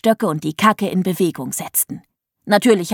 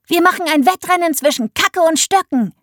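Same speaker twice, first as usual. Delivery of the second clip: excited; 8 semitones higher than usual. A narrator's reading. Child announces race.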